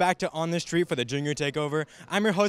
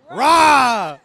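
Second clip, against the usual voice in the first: loud tiger roar; growling tiger voice; 4 semitones higher, 7 dB louder than usual. Pretend. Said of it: "let's hear your best tiger roar".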